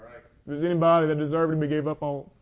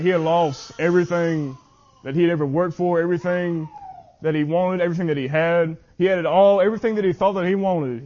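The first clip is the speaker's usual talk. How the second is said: rising, louder preaching build; building sermon; 3 semitones higher, 4 dB louder than usual. Acted. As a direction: climb toward the climax, pressing each phrase.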